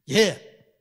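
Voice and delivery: sudden loud high yes; Jonah's loud startled yes; startled reply. Retold or imitated. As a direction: jump to answer when called. Jonah answering God.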